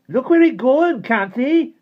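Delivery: put-on very high, loud snarl in a Cornish accent; furious Cornish-accented shout; angry Cornish snarl. A narrator's reading. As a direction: lash out as an angry Cornish boy. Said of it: "he snarled, the Cornish accent made ugly by anger".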